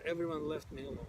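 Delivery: normal talking voice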